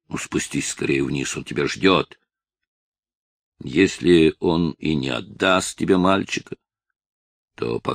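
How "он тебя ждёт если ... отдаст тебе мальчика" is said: ordinary delivery, nothing marked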